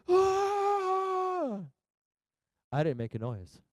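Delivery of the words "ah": higher, hammed-up yell of a kid; mock yell. Son yelling as the dragon dives. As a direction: imitate the son yelling on the drop. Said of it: "Hunter was like this".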